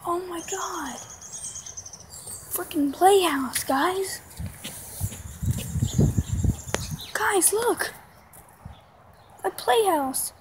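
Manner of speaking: high rising astonished call; surprised call. Real, not acted. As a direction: point it out in disbelief. Finding fallen playhouse.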